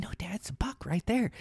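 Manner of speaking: whispered urgently, no voice; hushed whisper. Retold or imitated. Boy whispering to his dad about a buck, urgent whisper. Insisting to dad mid-hunt.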